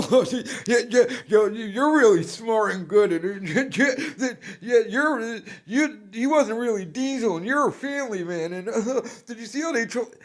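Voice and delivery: high, laughing, fawning yes-man voice; mocking sycophant. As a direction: gush high-pitched like a sneering suck-up, laughing through it. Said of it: "fake Landau and Chin there? Like".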